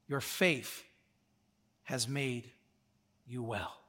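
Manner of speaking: slow, hushed and low, with space between phrases; softly bestow it, letting each phrase settle; tender, quiet pronouncement; gentle reassurance. Acted. Jesus gently answers the healed man.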